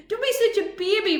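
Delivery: high, loud and cross; Rusty scolding crying Clang. A narrator's reading. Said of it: "Rusty said crossly".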